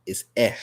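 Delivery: E said as a short eh; short vowel E, eh. Shown as a demonstration. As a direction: say E as eh, like in bed. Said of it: "The short sound for the vowel E".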